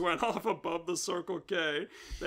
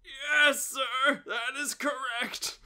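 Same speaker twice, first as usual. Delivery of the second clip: chirpy inspector; 6 semitones higher, 3 dB louder, slower than usual. Acted. Inspector affirming.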